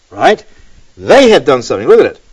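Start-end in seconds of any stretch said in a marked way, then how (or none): 1.0-1.9 brisk repeat stressing "they". Shown as a demonstration. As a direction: drive home the word "they". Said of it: "the emphasis here is".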